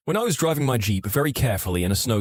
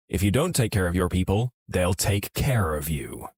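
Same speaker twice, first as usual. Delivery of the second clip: pointed warning; 5 semitones lower than usual. Acted. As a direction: drop the pitch and draw out "take care of you". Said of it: "He then stared directly at the old manager".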